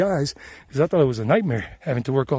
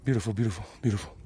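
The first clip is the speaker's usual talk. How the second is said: hushed awe; 5 semitones lower, 8 dB quieter, faster than usual. Real, not acted. Admiring mountain view aloud.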